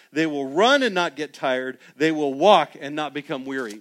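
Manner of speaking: rally the congregation; preaching at full force. Acted.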